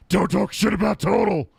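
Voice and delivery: high, loud outburst; shouted, outraged rant in a put-on voice; Tourette's Guy. Fan quoting a favorite video line, shouted impression. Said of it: "Tourette's guy".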